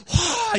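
high, loud "whoa"; imitated whoop of amazement. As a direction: burst out in mock awe. Acting out the preacher's exclamation.

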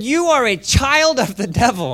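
high-pitched, loud dramatic denunciation; fiery rebuke. A narrator's reading. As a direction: read it as Paul confronting a sorcerer. Paul's rebuke read aloud dramatically.

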